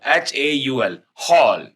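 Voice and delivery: ordinary talk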